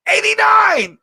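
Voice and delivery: high loud mock number call; mocking viewers. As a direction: bark the number like an eager viewer. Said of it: "they be like".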